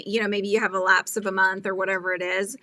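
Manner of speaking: normal talking voice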